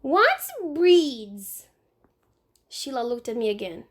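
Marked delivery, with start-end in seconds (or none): 0.0-1.7 put-on high little boy voice, slow; a small child explaining matter-of-factly. Fudge in the tree explaining to Sheila, in a high little boy voice.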